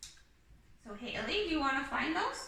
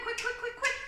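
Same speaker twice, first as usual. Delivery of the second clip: quick, quick, quick, quick rattled off fast and high; rapid-fire quick; 9 semitones higher than usual. Shown as a demonstration. Teacher showing a child how fast to read. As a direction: fire off each quick like a flashcard drill. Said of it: "you wanna be able to read 'em quick".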